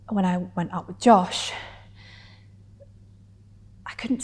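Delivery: measured dramatic telling with long pauses; confessional stage monologue. Acted. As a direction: let each memory land, pausing between phrases.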